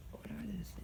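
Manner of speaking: unvoiced whispered aside. Real, not acted. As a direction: whisper it to yourself. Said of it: "whispered aside".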